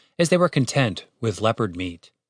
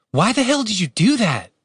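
higher, exasperated question; scolding; 10 semitones higher than usual. A narrator's reading. Catherine questions her brother about shooting, scolding. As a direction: demand an explanation.

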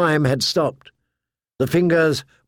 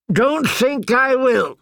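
put-on slow, higher defiant old man; gruff old soldier; 11 semitones higher, slower than usual. A narrator's reading. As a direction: refuse flatly. Yoren refuses.

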